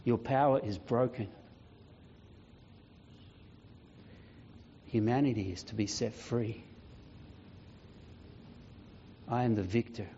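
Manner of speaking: low, soft, with long weighty pauses; solemn declaration. Acted. Jesus tells the devil his power is broken, solemn declaration. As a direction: pronounce it with quiet, final authority. Reads Jesus's letter to Death.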